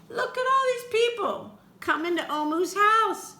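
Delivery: high loud excited gasp and call; amazed. Acted. Storyteller amazed at crowds at Omu's house, high excitement. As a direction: wow the kids with the crowd.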